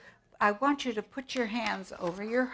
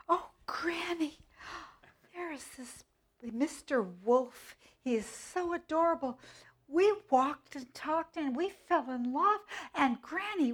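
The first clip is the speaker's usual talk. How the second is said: put-on higher, breathless confiding child; little girl puppet voice; 7 semitones higher than usual. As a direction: confide dreamily in Granny. Little Red confides about wolf.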